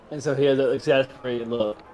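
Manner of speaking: ordinary talk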